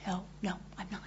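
softly denying being that person; quiet denial. Retold or imitated. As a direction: murmur it, hoping not to be recognized. Denying quietly in retold scene.

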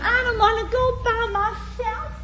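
put-on high, laughing pleading little girl voice; little Faye pleading. Faye begging Ralph to go with her, high laughing plea. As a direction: whine it like a scared child begging. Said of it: "She says".

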